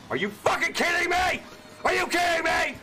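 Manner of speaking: shouting in disbelief; raging gamer. Real, not acted. Raging at the game.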